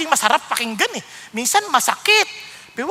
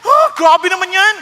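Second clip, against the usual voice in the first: mock offended; 6 semitones higher, 8 dB louder than usual. Mimicking an offended churchgoer.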